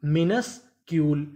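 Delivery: मिनस्क्यूल slowly, as minus ... Kiul; slow two-part reading of मिनस्क्यूल; broken into parts. Shown as a demonstration. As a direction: pause inside मिनस्क्यूल after minus. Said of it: "टुकड़ों में तोड़ तोड़ के पढ़ें".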